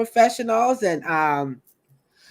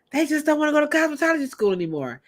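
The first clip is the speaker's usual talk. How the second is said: mock dismissive; 7 semitones higher, much faster than usual. Mocking people who skip school.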